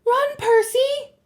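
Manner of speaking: high, loud, drawn-out call; urgent high cry; shouted warning. A narrator's reading. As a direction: shout for a friend to flee. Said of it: "Annabeth's voice shouted".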